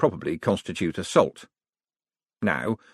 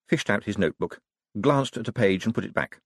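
usual speaking voice

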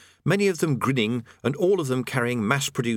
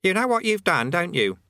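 put-on high, reproachful boy; accusing boy; 9 semitones higher, 3 dB louder than usual. A narrator's reading. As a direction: scold like a know-it-all kid. Eric scolds Rincewind.

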